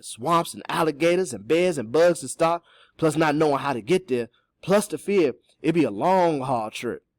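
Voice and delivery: emphatic character warning; cautioning Cato. Acted. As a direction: pile up each danger with emphasis. Voices Cato listing dangers.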